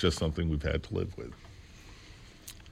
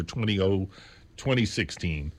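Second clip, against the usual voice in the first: ordinary delivery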